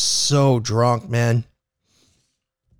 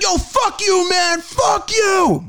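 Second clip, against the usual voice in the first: high-pitched mock outburst; acted, high, defensive shouting; angry yelling; 14 semitones higher, much slower than usual. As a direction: yell like an offended drunk passenger. Retold drunk passenger lashing out.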